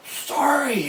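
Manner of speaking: put-on very high little-kid squeal; re-enacted childhood apology. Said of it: "that I was able to say it".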